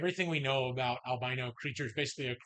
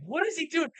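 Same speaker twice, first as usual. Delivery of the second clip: mock bafflement; 12 semitones higher than usual. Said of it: "And they're like".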